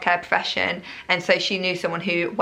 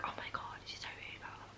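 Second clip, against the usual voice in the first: hushed whisper, much softer; 25 dB quieter, faster than usual. Retold or imitated. Jack behind the curtain, whispering about the nurse. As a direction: whisper so the nurse can't hear. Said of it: "because she was on the other side of the curtain".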